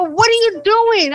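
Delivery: high, loud, slow startled question; high drawn-out alarmed staff voice; alarmed demand. Retold or imitated. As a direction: stop them in shock.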